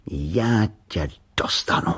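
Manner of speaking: slow, menacing threat; boy's drawn-out menace; dangerous voice. A narrator's reading. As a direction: threaten it slowly, word by word. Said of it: "řekl nebezpečným hlasem".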